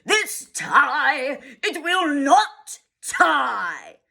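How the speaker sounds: a hint of a yelling father; mild mock yell. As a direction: drag out the fury. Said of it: "He really yelled".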